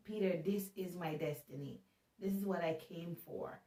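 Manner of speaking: hushed, quietly sure of his fate; gentle Jesus. Retold or imitated. Jesus gently telling Peter his destiny, a soft calm tone. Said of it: "he's like".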